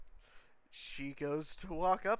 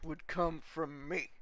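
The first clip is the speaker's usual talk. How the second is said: gruff commander; 3 semitones lower, 10 dB louder than usual. Acted. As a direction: bark it down at the new boy like a drill sergeant. GM voices the commander.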